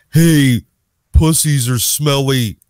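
loud, hammed-up punchline; mock joke delivery. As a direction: blurt it out like a crude gag.

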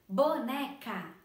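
"boneca" slowly split as bo-ne-ca; slow, firm syllable count on "boneca". Shown as a demonstration. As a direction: give each of the three syllables of "boneca" its own beat. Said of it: "três sílabas".

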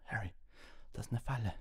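high strained voice in pain; just broke a bone. Acted. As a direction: force it out through pain. Injured Ron warning in pain.

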